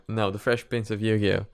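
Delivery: ordinary talk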